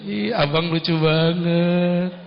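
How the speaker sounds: slow, cooing and coy; sweet teasing wife. Acted. Wife holds husband's chin and coos sweetly. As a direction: melt him with sweetness.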